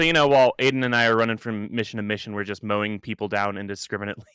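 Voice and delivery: ordinary talk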